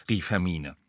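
ordinary delivery

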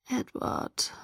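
very soft, breathy murmur; hushed, intimate call. A narrator's reading. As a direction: murmur the name almost in a whisper. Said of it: "murmelte ich".

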